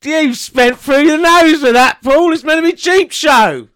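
громкое насмешливое подтрунивание сквозь смех; loud, laughing teasing, drawn out; laughing tease. Real, not acted. Teasing partner, laughing loudly.

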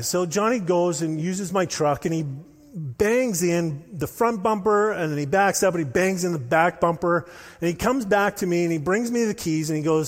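ordinary delivery

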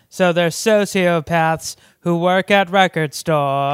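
loud, declaimed alien proclamation; mock alien. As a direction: announce it as an alien rebuilding a society. Playing aliens studying the movie after apocalypse.

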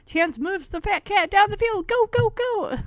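high, excited play-by-play imitation; sports commentator. Dog narrating his own game against the cat, mock commentary. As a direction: call it like a mock match commentator. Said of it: "I love how Chance is commentating".

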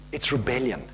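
low, quiet pronouncement; God's verdict. Acted. Preacher voicing God's answer to excuses, low quiet verdict. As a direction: drop down low and soft, utterly final. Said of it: "God says".

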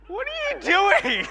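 high, slow, stretched-out shocked question; shocked disbelief. Retold or imitated. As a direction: squeal it in disbelief. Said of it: "I'm like".

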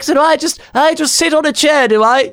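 high, fussy put-on old woman voice; mock dithering old lady. Mocking the woman who won't sit down, high and fussy.

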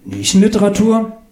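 Nischenliteratur as a high, rising question; puzzled echo. Acted. Claudius echoes the author, Nischenliteratur high and questioning.

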